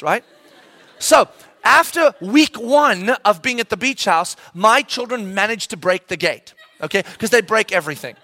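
ordinary talk

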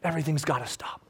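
low, soft and grave; hushed repeat. Acted. Preacher repeating the plea to the crowd, hushed. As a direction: drop right down after the shouting.

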